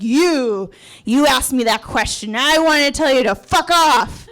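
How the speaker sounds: put-on loud drunken accusation building to a shout; drunken rant. Drunk patient confronting the staff, loud indignant rant. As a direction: tell them off drunkenly, exploding at the end. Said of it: "I sound drunk and I feel drunk".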